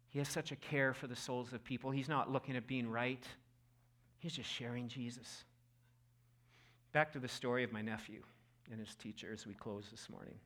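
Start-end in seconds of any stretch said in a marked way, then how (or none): none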